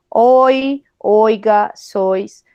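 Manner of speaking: hoy, oiga, sois with the vowel pushed to the back of the mouth. Shown as a demonstration. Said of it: "¿Viste que ahí lo pronuncio hasta atrás?". ("hoy, oiga, sois" con la vocal bien atrás)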